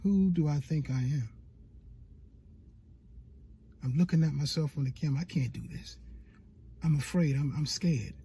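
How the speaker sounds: low, soft, mock-fearful inner voice; small hushed voice of self-doubt, acted; scared self-doubt. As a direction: drop low and quiet, as if doubting yourself. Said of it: "Your voice is saying".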